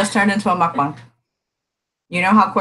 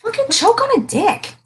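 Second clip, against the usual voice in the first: much higher, shrill-free yelled insult; high shriek; 13 semitones higher than usual. Acted. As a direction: fling it out as a high mock yell. Mock insult outburst.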